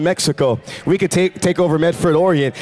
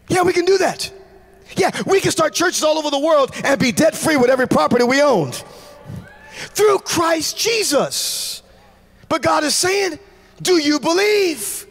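rising preacher shout; 9 semitones higher than usual. Acted. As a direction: lift the crowd with it. Preaching at full force.

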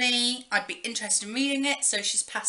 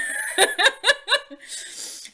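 high, loud burst of real laughter; bright laugh; 13 semitones higher, 3 dB louder than usual. Real, not acted. Laughing while telling viewers about a murder book. Laughing at her own story.

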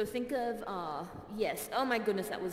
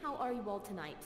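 soft high greeting; 4 semitones higher, 8 dB quieter than usual. Acted. Host greeting audience in character.

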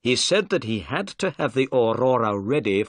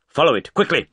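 loud, driving orders, higher on the first Faster; urgent shouting; 4 semitones higher, 5 dB louder than usual. A narrator's reading. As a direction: urge the crew on at full voice. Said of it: "cried Holmes".

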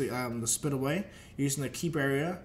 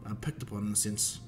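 ordinary delivery

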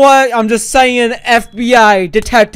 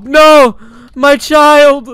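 mock anguish; 6 semitones higher, 8 dB louder, much slower than usual. Mock grief over teammate.

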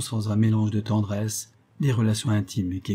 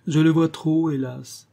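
high, loud start falling away on "hélas"; anguished cry; 7 semitones higher, 4 dB louder than usual. A narrator's reading. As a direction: cry out in mild despair. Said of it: "s'écria Béatrix".

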